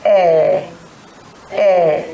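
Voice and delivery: a hint of a deaf old person asking "Eh, eh"; mock hard of hearing. Shown as a demonstration. Teacher voicing a girl pretending not to hear. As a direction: play an older person who can't hear. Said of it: "She says".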